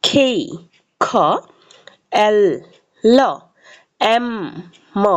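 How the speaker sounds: isolated letters, aspirated খেই and খ; letter names and sounds. Shown as a demonstration. Teacher models alphabet sounds for learners, careful isolated syllables. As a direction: recite name, pause, then sound. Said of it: "সঠিক উচ্চারণ এবং সাউন্ডগুলো জেনে নিই".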